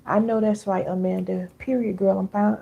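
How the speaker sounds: usual speaking voice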